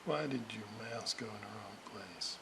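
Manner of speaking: ordinary talk, nothing marked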